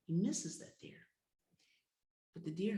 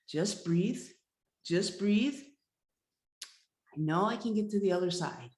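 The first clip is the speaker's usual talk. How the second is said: louder, urging himself on; anxious self-coaching; 3 semitones higher, 7 dB louder than usual. Acted. Hunter on thin ice, urging himself to breathe, anxious. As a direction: push through the fear.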